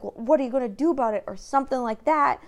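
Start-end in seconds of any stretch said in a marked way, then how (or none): 0.0-1.2 quick cocky taunt, spat out fast; taunting challenge. Retold or imitated. Retelling the guy who grabbed her buddy, fast mock taunt. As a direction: dare him, fast and smug. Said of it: "the other guy said something like".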